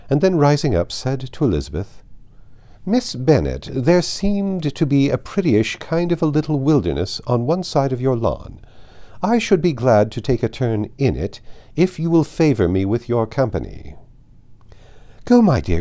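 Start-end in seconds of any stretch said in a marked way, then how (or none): 2.9-14.0 higher, louder, commanding grand dame; imperious aristocrat. Acted. Lady Catherine commands Elizabeth. 15.2-15.8 eager motherly call, much louder; cried out. Acted. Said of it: "cried her mother".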